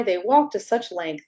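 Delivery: usual speaking voice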